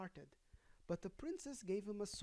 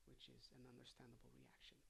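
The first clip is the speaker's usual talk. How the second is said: quick aside; 4 semitones lower, 17 dB quieter, faster than usual. Real, not acted. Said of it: "quick muttered aside".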